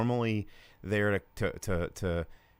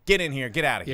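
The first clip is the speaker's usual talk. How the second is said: louder, higher mock herding call; imitated staff ordering; 4 semitones higher, 6 dB louder, faster than usual. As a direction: rush them through like event staff. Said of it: "They were just like".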